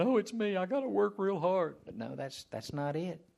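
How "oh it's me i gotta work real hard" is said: high-pitched imitation of anxious effort; mock striving. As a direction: put on a hammed-up, overburdened believer. Mimics believer taking on all effort.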